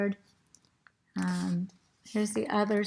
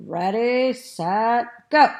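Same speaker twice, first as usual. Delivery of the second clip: loud, drawn-out start signal; race starter's call; 2 semitones higher, 7 dB louder, slower than usual. A narrator's reading. Mister Morales starting the race, called out. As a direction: call the start to the whole room.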